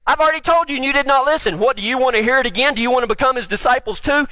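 high-pitched, mildly exasperated challenge; defiant retort. Acted. Healed man challenging religious leaders, defiant retort. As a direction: fire questions back at the accusers.

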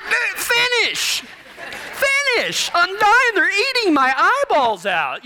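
high, comic desperate begging; exasperated plea. Retold or imitated. Cowboy begs his buddy to finish doctoring, comically desperate. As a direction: plead as if being eaten alive.

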